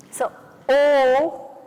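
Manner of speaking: long loud oh; loud oh demo; loud dropped oh. Shown as a demonstration. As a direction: drop it to the floor. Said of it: "Really drop it this time".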